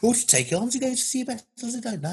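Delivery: high Brummie voice, tossing in everyday asides; Brummie Shakespeare. Shown as a demonstration. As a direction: recite Hamlet as a chatty Brummie. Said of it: "in a Brummie accent".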